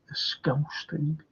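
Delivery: slow whisper of a Scouse thing, voiceless. Shown as a demonstration. Repeats phrase, then whispers it.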